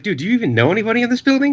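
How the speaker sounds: high, rushed, panicky; panicked fast question. Retold or imitated. Retold panicked question to friend.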